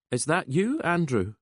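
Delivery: lightly voiced woman. A narrator's reading. Woman answering the phone.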